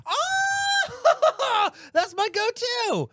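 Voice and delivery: high, loud squeal of outrage; shocked cry. Real, not acted. Friend hearing her favourite donut insulted, shrieking in shock. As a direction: yelp as if personally wounded.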